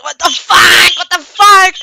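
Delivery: high, very loud shocked yells; horrified shouting. Retold or imitated. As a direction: yell as if just badly hurt. Character's arm is torn off.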